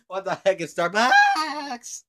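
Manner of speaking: mocking the skit while cracking up, loud; laughing outburst. Real, not acted. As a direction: blurt it out laughing.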